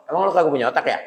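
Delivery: blunt mocking jab; cheeky Betawi taunt. Shown as a demonstration. Preacher shows the congregation the punchy Betawi version, teasing. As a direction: say it like a street insult. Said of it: "Nah, itu tuh".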